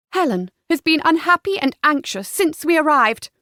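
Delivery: forceful, exasperated daughter; temper snapping. A narrator's reading. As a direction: scold the parents indignantly. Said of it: "Cleo's temper finally snapped".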